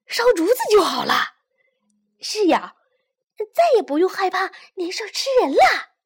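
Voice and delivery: loud, fast joyful villagers; excited, happy. Acted. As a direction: cheer with relief. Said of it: "高兴地说".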